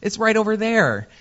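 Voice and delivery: high, honeyed mock-friendly voice; sweet Midwest lady. Imitating a friendly local giving directions, high and honeyed. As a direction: coo it like a Midwestern auntie. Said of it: "you're like".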